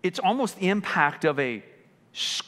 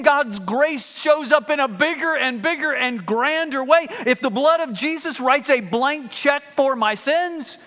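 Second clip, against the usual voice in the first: mock believer; 8 semitones higher, 6 dB louder than usual. Mocking a careless believer.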